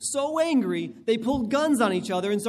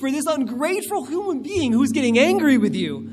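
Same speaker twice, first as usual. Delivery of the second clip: parent's rising anger; 7 semitones higher, 6 dB louder than usual. Pretend. Parent's swelling angry thoughts.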